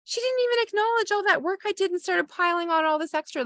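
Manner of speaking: venting to a partner who finally agrees, high and whiny; relieved venting. Retold or imitated. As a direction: pour out the grievance, high and aggrieved. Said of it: "And then you're like".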